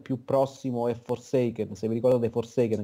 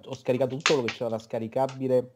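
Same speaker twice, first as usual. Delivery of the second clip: ordinary talk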